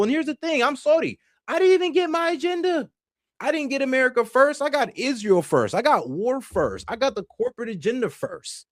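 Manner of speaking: high-pitched, indignant complaint; aggrieved protest. Real, not acted. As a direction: pitch it way up, listing each grievance. Airing grievance at broken promises.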